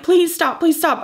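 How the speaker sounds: high, urgent retold plea; imitating a tearful, repeated plea; begging. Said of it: "begging Ian to please stop screaming".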